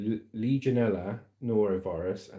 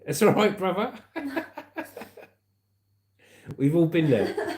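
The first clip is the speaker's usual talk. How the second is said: laughing; 8 semitones higher, 3 dB louder than usual. Real, not acted. Laughing at a viewer's comment.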